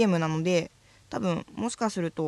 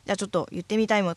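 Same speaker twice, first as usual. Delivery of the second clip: ordinary talk, nothing marked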